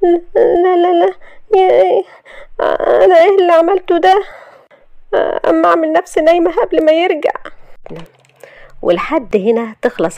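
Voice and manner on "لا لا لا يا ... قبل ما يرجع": high, loud, whiny fretting; panicked wail. Acted. Shahd alone regretting her boldness, panicky wail. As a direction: wail in mock-tearful regret, pitched way up.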